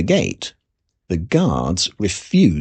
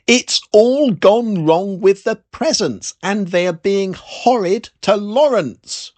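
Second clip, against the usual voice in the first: louder, slower, over a bad phone line; shouting; 10 semitones higher, 4 dB louder, slower than usual. A narrator's reading. Ethel shouting down the phone to Father Christmas. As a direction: call it out so he can hear. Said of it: "she shouted".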